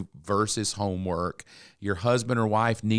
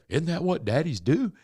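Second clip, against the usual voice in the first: joking; 7 semitones higher than usual. Retold or imitated. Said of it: "then I made a joke".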